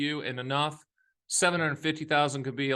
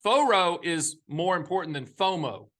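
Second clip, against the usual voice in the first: ordinary talk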